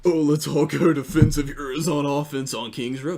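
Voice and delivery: hammed-up, loud joking suggestion. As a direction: call it out as a silly joke. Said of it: "we made a joke".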